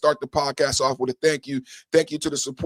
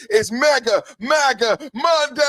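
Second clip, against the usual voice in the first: hype shout; 7 semitones higher, 4 dB louder, slower than usual. Real, not acted. Hyped podcast opener catchphrase.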